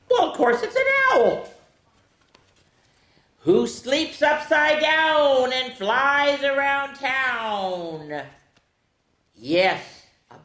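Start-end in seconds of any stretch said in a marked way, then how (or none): 0.1-1.5 high owl voice. Acted. Answers in owl voice. 3.4-8.2 slow spooky reading. Acted. Reading riddle to kids.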